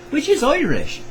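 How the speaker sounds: high, lilting Irish accent. Shown as a demonstration. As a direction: put on a bright-pitched Irish brogue. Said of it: "That was Scottish, Irish".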